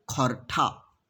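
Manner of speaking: spoken low as a demo word; a low, separate demo of the Khortha form; Khortha pronunciation. Shown as a demonstration. Teacher modelling the Khortha word. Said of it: "तो ये क्या हो जाएगा?".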